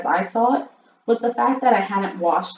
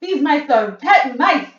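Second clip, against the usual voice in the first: shouting; 7 semitones higher, 9 dB louder, slower than usual. A narrator's reading. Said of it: "she shouted".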